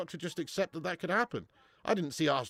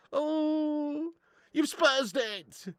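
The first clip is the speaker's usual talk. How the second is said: mock drawn-out taunt; 12 semitones higher, much slower than usual. Mocking a Spurs fan.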